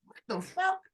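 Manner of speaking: hushed astonishment at the moaning; stunned recruits. Retold or imitated. Recruits staring at him, shocked. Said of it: "They were like".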